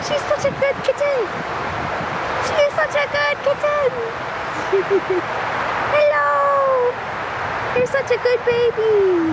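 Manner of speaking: high, fast thrilled cheering; overjoyed squeals to the cat; excited. Real, not acted. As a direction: cheer the kitten running in. Cat runs to her in snow.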